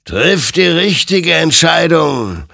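loud, high, drawn-out warning; booming otherworldly admonishment; stern admonition. Acted. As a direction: exhort him forcefully, stretching the words. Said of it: "ermahnte ihn sein Gegenüber".

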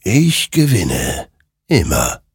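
slow, portioned-out words, sinking after the start; triumphant creature. A narrator's reading. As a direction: declare it slowly, word by word, as the eternal victor. (langsam und bedeutungsschwer verkündend)

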